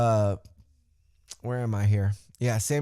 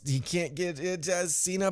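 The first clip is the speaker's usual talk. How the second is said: ordinary talk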